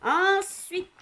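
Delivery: high, loud ringmaster announcement; voix de cirque. Real, not acted. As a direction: call it out like a circus announcer. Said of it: "je prends ma voix de cirque". (voix de cirque, aiguë et forte)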